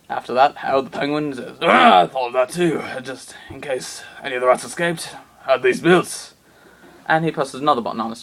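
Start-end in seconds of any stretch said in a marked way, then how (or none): 1.6-6.3 smug villain voice. Acted. Harold reveals backup plan.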